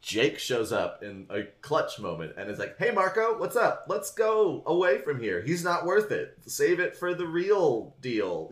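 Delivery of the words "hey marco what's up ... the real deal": much higher, gentle coaxing voice; soothing friend. Retold or imitated. Jake calming Marco, high voice.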